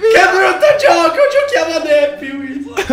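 very high, slow drawn-out wail; panicked wail. Real, not acted. Chased player begging for another game, panicked wail. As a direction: wail your complaint at the top of your range.

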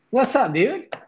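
high, bright hello to a friend; excited greeting. Real, not acted. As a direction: greet him like you're thrilled. Greeting a guest on stream.

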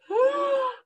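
high, sudden gasp of realization; sharp gasp. Acted. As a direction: gasp as it dawns on him. Gerald realizes egg.

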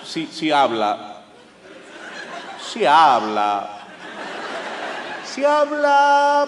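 very slow, hesitant Spanish; halting gringo. Retold or imitated. The gringo answering in broken Spanish, very slow and halting. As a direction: stretch each word like a learner.

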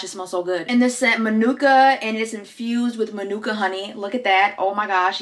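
ordinary delivery

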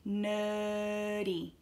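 "Nerdy" with a long held er; slowed down. Shown as a demonstration. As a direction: stretch the first vowel of "Nerdy", no R. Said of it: "practice slowing it down".

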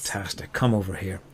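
low, hushed Irish brogue; Irish elf. Acted. Elf invites the barbarian over for moonshine, low quiet Irish accent. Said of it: "the elf is Irish".

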